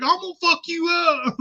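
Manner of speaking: high, strained mock panicked threat; hammed-up high-pitched frightened yell. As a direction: squeal it while running from the snake. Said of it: "be like".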